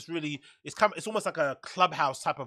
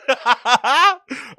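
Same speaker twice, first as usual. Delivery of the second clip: high, loud burst of laughter; real big belly laugh; 9 semitones higher, 9 dB louder than usual. Real, not acted. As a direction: crack up at your own joke. Cracks himself up at own bit.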